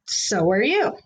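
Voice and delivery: plain reading voice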